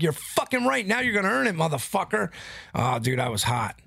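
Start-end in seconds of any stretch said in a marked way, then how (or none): 0.0-2.3 high, defiant retold comeback; answering the referee's penalty, retold defiance; retold defiant anger. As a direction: throw the taunt back at the ref. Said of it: "I'm like".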